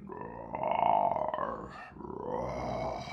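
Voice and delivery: low, soft growl; distant lion roar. Acted.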